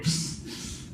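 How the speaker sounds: voiceless "psst" zap sound; hissed sound effect; imitated shock noise. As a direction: imitate a shock with a sharp hiss. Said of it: "and you hear him".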